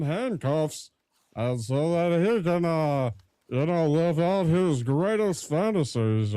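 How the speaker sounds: a lightly voiced lunk musing aloud; dumb henchman. Shown as a demonstration. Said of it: "he's just talking like this".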